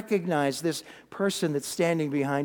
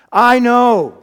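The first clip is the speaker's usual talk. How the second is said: emphatic angel; 2 semitones higher, 10 dB louder than usual. Acted. Angel proclaims to Mary.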